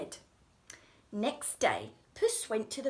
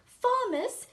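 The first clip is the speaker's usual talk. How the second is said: higher call to the farmers; 13 semitones higher, 6 dB louder than usual. Acted. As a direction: call across the field. Cat calls to farmers.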